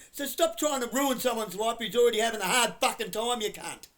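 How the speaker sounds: enraged tirade aimed at bullying cops; furious yell. Real, not acted. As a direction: tear into the officers at full volume. Berating police officers.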